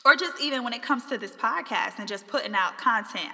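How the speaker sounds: ordinary talk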